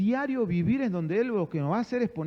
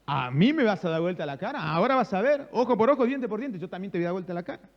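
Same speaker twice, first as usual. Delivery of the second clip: mock indignation. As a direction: pretend affronted retort, building to a threat. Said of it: "Yo pudiera decir". (indignación fingida, con tono de amenaza)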